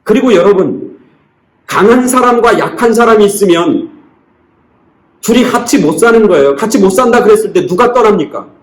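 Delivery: impassioned preaching. Acted. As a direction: preach it hard, pressing each question on the flock.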